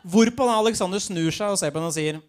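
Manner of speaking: usual speaking voice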